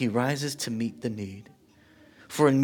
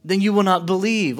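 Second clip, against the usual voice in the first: loud preaching; 5 semitones higher, 5 dB louder than usual. Retold or imitated. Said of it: "Jesus saying".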